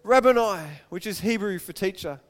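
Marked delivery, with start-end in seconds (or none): 0.0-0.7 loud cry of recognition; raised-voice exclamation. A narrator's reading. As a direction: call it out to him, louder. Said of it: "cried out".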